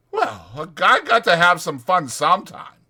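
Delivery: chatty ranch hand selling a night in town; Whit's voice. Acted. Voicing Whit.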